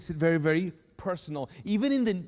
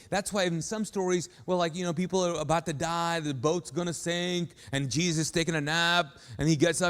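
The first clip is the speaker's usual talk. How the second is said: normal talking voice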